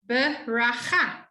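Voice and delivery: "beracha" slowly, with a guttural ch; slow model word. Shown as a demonstration. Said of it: "you'll see this very often".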